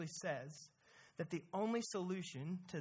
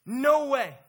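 much louder protesting no; mock rebuke; 6 semitones higher, 11 dB louder than usual. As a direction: refuse loudly like an upset friend. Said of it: "he says".

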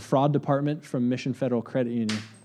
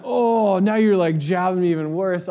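high, loud wounded protest; answering the scammer's insult, raised and high; offended; 4 semitones higher, 4 dB louder than usual. Retold or imitated. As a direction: take the jab personally. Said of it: "And I'm like".

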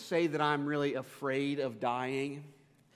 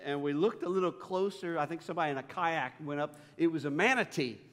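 normal talking voice

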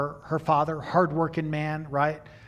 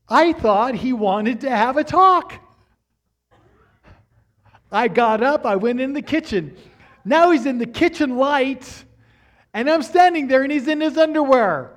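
1 excited storytelling; 9 semitones higher, 9 dB louder than usual. Real, not acted. Comic story climax to congregation.

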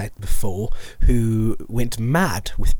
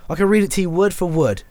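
higher, urgent protest; insistent; 8 semitones higher than usual. Acted. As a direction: push back, certain it's right there. Player insists the page has words.

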